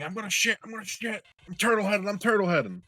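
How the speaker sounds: high, frantic mock panic; urgent panicked yell. Friend racing past on a bike, high panicked mock yelling. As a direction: play the desperate friend about to lose it. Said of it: "Chris zooms past us saying".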